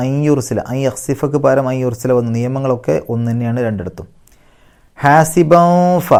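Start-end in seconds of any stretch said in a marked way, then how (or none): 5.0-6.1 very slow, higher chant; Quran recitation. Shown as a demonstration. Teacher chants the word to show the ikhfa. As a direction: draw the word out slowly. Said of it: "ഇഖ്ഫ ആണ് നിയമം".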